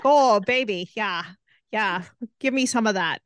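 mock-seductive Minnesota accent, oh you betcha. Teasing her co-host with a jokey Minnesota come-on, mock accent. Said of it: "really strong Minnesota accent".

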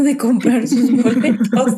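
words broken by laughter; laughing. Real, not acted. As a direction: say it while laughing. Laughing while telling.

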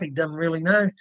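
usual speaking voice

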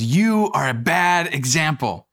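slow, mock-scolding wife voice. Wife scolding her husband over wrappers, mock stern. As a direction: drag it out like a disapproving wife. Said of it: "my wife goes".

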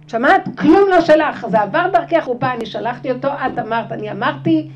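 loud, insistent divine voice; loud inner voice. Acted. God's voice telling her it passed through her, loud insistent. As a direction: thunder it out as a revelation. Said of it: "זה לא שלך בכלל".

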